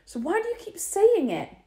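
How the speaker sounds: high, loud accusing question; high, loud confrontation; angry demand. A narrator's reading. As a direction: demand it furiously. Lloyd confronting Dinah.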